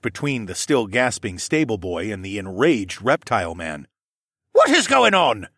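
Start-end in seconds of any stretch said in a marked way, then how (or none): 4.5-5.5 high, loud demanding yell. A narrator's reading. Captain arriving at a brawl, yelling. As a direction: yell the question across a deck. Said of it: "Captain Nemus yelled".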